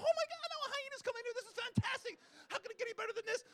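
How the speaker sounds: high, thrilled gasp of delight; excited. Retold or imitated. Photographer recalls spotting the hyena, high and thrilled. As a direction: squeal at the hyena's arrival. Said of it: "I'm going".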